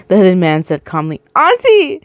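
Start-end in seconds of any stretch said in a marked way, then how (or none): 1.3-2.0 a high, desperate call; crying out. A narrator's reading. Boy calling to his aunt, high cry. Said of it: "Ethan cried".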